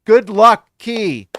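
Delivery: loud. Real, not acted. Cheering a viewer on.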